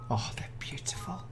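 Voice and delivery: hushed, breathy "they're beautiful"; awed whisper. Real, not acted. YouTuber admiring a baby rabbit, awed whisper. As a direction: whisper it in awe. Admiring the New Zealand kit.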